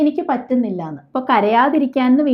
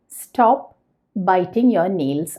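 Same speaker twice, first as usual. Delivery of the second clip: drawn-out, word by word; slow model sentence; 5 semitones lower, much slower than usual. Shown as a demonstration. Teacher showing learners the English sentence, very slow. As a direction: dictate it slowly to the class. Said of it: "teacher models English sentence".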